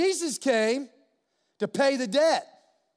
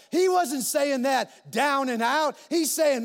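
normal talking voice

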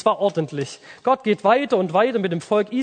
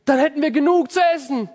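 mock crying out; 7 semitones higher, 3 dB louder than usual. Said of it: "Und dann schreien sie".

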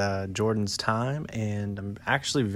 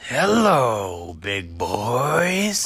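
breathy vintage purr, louder; Val Venus impression; 6 dB louder, much slower than usual. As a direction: purr the greeting like a smooth seducer. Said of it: "Hope you like my Val Venus impression".

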